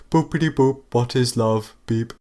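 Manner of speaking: robot beeps. Pretend. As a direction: play the dumb robot. Keeping up the robot act.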